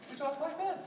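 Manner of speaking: high, soft courting voice. Shown as a demonstration. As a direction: lift the voice up high and soft to woo. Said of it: "all men speak higher".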